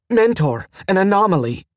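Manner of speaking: sharp, high-pitched alert; suddenly sharp. A narrator's reading. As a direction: call out a sudden warning, pitch jumping up. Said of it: "and suddenly sharp".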